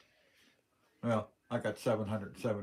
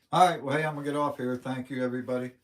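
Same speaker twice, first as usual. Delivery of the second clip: much louder, higher "all right, well, hey"; loud cheery sign-off; sign-off call; 4 semitones higher, 9 dB louder, faster than usual. Real, not acted. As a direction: call out to wrap up the stream. Signing off the stream.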